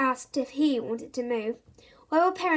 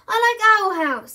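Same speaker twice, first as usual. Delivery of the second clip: higher, louder stubborn insistence; insisting; 8 semitones higher, 9 dB louder than usual. A narrator's reading. Bertie insists to Dad, stubborn. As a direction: dig in your heels.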